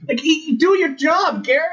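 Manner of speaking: high-pitched, exasperated outburst; exasperated mock scolding. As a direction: scold him in comic disbelief. Mock scolding an absent character.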